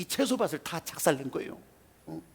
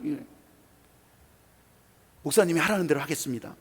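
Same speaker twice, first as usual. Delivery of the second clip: ordinary talk, nothing marked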